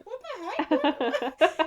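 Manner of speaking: high, loud, stretched-out disbelief; mock bewilderment. Viewer sees a guy start breakdancing, high amused disbelief. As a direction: draw it out, high and laughing.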